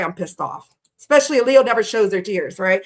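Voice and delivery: usual speaking voice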